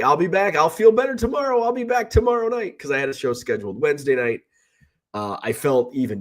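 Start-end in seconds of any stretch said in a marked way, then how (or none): 0.0-2.8 retelling his own upbeat promise, pitched higher; a bright, chirpy reassurance that he will be back, much higher. Retold or imitated. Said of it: "I'm like".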